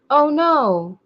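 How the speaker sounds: stiff, stilted robotic voice. Retold or imitated. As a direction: recite it like a clunky robot. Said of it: "with this robot voice".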